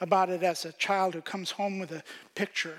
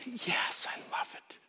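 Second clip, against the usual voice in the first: soft whispered reassurance; 4 semitones higher, 6 dB quieter than usual. Pretend. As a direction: whisper it much softer. Mother reassures child softly.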